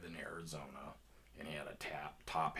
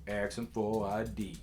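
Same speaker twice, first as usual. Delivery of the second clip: firm, louder voice without yelling; big dude up close; 3 semitones higher, 8 dB louder than usual. Retold or imitated. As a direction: pass on street advice as the big dude.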